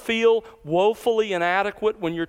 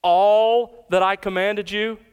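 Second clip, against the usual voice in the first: "All" leaned on, slower and louder; slow emphatic "All" to open the verse; stress on all; 4 dB louder, slower than usual. Shown as a demonstration. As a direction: hit "All" hard and slow down. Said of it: "don't miss the significance of that little word all".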